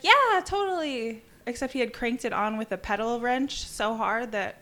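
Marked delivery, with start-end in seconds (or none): 0.0-1.2 high, slow, dopey yeah; breezy agreement. Retold or imitated. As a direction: play a carefree dude saying sure. Exhausted rider agreeing casually.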